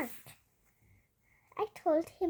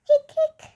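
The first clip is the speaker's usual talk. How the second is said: sharp high hic sounds, much louder; loud hiccups; 12 semitones higher, 13 dB louder than usual. Acted. Child reading the story acts out loud hiccups. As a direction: pop out two big hiccups.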